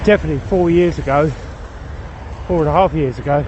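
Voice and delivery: panting between phrases after a climb; out of breath. Real, not acted. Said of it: "huff and puff like this".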